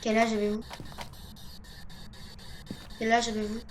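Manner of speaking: normal talking voice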